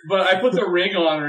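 ordinary talk

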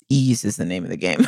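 low, laughing mock-sage voice; mock wise motto. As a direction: pronounce it like a slogan. Self-quote, mock solemn motto.

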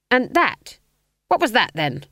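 put-on demanding old witch. A narrator's reading. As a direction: demand an answer sharply. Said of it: "demanded Granny".

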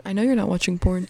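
ordinary delivery

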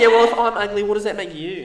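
high laughing playground retort; childish comeback. Retold or imitated. Partners bickering like kids, high laughing comeback. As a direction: sound like a five-year-old talking back. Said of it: "back and forth".

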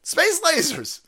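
high emphatic yell; shouting. Real, not acted. Ranting to viewers about space lasers, high yell. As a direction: shout it out in exasperation.

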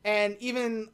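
usual speaking voice

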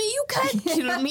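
high, slow villain taunt; mock gloating villain. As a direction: taunt them as a hammed-up villain. Villain returns gloating in retelling.

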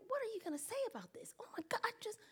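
high, hushed, fast plea for help; breathless whispered panic; panicked whisper. Retold or imitated. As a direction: whisper a silent SOS to a friend. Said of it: "Then I'm looking at Maureen".

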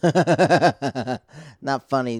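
slow words through a real laugh. Real, not acted. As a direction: laugh at your own joke as you say it. Laughs at own dark joke.

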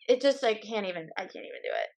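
ordinary talk, nothing marked

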